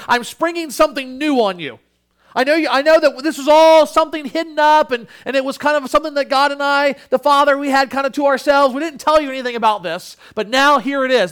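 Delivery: hammed-up secret-keeping voice; hypothetical Jesus. Preacher mocking what Jesus did not say, hammed-up. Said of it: "he doesn't say".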